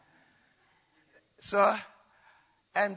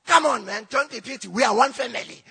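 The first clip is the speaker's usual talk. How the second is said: loud, higher-pitched imitation of a scolding mother; mock scolding; 6 semitones higher, 8 dB louder than usual. Mum hits Alex on the head and tells him off, loud mock scolding. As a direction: act out a mum telling off her son, loudly.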